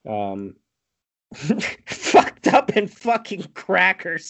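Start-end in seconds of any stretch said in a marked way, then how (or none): 1.9-4.3 high, slow strained yell through laughter; hammed-up crazed holler, giggling; mock yell. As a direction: imitate a madman's cry, drawn out. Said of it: "he yells".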